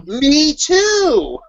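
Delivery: high-pitched eager coincidence; mock delighted surprise. Fellow writer discovering the same idea, mock delighted. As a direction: pitch it up, gushing on the last words.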